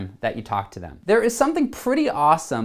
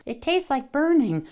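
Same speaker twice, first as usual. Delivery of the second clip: much higher cartoon child voice, dazed; Ralph Wiggum; 10 semitones higher than usual. Joking aside mimicking a cartoon boy, high goofy voice. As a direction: toss in a goofy cartoon kid line. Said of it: "Not Ralph from The Simpsons".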